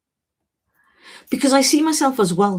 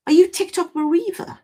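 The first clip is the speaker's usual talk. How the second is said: surprised question; 10 semitones higher than usual. Real, not acted. Recognising a viewer from Peterborough.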